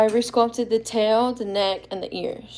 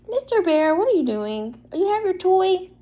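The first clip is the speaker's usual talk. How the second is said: higher, sing-song coo to the dog; 9 semitones higher than usual. Real, not acted. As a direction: sweet-talk the pet. Talking to her dog.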